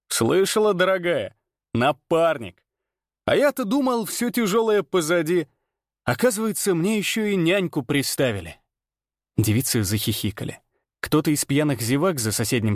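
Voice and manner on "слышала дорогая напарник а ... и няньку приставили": mocking showman. Ranner mocks hero to girl.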